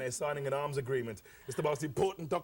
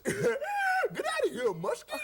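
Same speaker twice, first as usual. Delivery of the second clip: much higher, slow cartoon yell; cartoon bank manager; 4 dB louder, slower than usual. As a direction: bark it like a squeaky cartoon character. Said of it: "the bank manager says".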